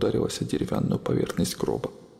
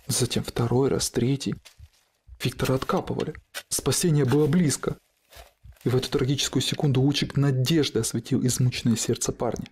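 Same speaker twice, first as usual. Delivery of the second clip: usual speaking voice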